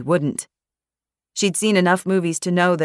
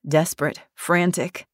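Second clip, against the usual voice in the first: plain reading voice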